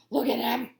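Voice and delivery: ordinary delivery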